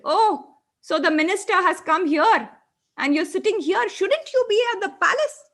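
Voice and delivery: high, loud mocking jibe; sarcastic taunt. A narrator's reading. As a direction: needle him with sarcasm. Spirit mocks crying Ramu.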